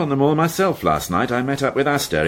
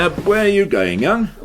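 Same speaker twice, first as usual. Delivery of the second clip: higher and louder, a sudden stop-him question; sharp call-out; 9 semitones higher, 4 dB louder than usual. Acted. Inspector stopping the young constable at the door, sharply surprised. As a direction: catch him before he walks out.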